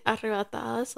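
"arrebatadas" in Spanish pronunciation; Spanish word. Shown as a demonstration. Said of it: "Spanish we say".

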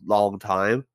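ordinary delivery

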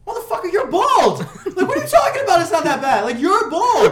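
high, loud laughing rant; mock outraged yell. Retelling yelling at his bald dad, mock outraged. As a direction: yell it at him in disbelief.

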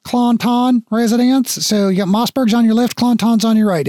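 high guard voice giving directions; friendly guard. Acted. Guard explaining which residence is which, high and helpful.

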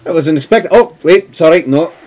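flustered priest caught off guard; startled priest. Acted. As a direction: jump in surprise, then catch yourself.

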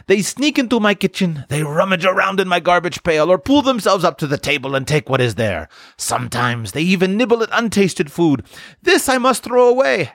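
high-pitched indignant complaint; complaining woman. A narrator's reading. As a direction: list the offences in a high, fussing woman's voice. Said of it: "complained a woman".

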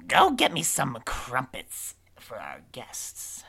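loud, slow command; witch giving orders. Acted. Witch sending crow for crumpets for guests, drawn-out holler. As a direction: holler it out, stretching the words.

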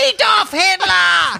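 high, drawn-out mock shout. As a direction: stretch the name out like a hype man's tag. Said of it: "He says his name like [name]".

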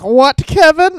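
high, loud startled grandma; mock grandma. Grandma replying to a rude letter, high and loud mock shock. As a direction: squawk it as a baffled grandmother. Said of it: "and then she sends back".

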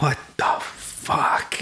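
slow, breathless whispered disbelief; stunned whisper. Retold or imitated. Tony seeing his sister come down in a bathrobe, shocked whisper. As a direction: mouth it in shock, barely voiced.

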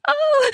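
high sharp squeal of surprise. Acted. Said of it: "I squealed".

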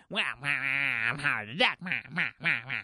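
squawking villain waddle voice; Penguin voice. Retold or imitated. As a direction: honk it like a cartoon villain. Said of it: "It's like Penguin now".

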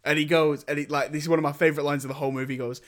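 usual speaking voice

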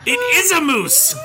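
high laughing gasp. Real, not acted. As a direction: squeal it through laughter. Cracking up over the moose joke.

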